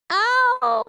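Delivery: sharp high yelp of pain; pained scream. A narrator's reading. Rat screaming at a lost tail. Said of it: "screamed Roscuro".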